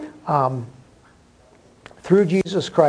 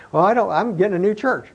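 quick, higher mock retort; lightly acted objector, pitched up; put-off newcomer; 5 semitones higher, 4 dB louder, faster than usual. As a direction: blurt it as someone backing out fast. Voicing a put-off newcomer's reply.

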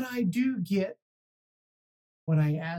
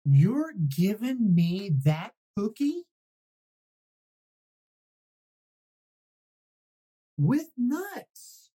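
amazed disbelief. Acted. Pigeon amazed at gift.

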